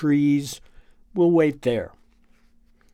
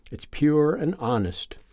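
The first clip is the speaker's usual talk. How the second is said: ordinary delivery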